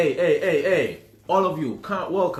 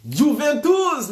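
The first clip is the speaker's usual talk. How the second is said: excited reveal; 10 semitones higher, 6 dB louder, much slower than usual. Real, not acted. Revealing the quiz answer.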